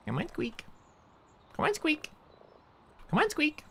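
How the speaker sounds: very high coaxing call; coaxing the cat. Real, not acted. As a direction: lure the kitty out, way up high.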